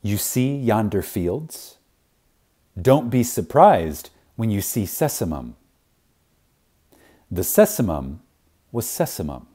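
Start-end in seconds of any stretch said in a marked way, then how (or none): none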